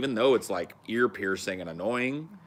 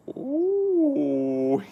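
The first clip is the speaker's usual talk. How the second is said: high, drawn-out "ooh" into loud giggle; mischievous peeping coo; 6 dB louder than usual. Acted. Poking up to look down at someone, high giggly coo. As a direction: squeal an ooh, then cackle in falsetto.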